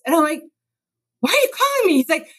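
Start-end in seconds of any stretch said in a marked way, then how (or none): 1.2-1.9 much higher, exasperated question; mother baffled by her fleeing son's call; incredulous mom. Retold or imitated. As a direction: ask him why on earth he called.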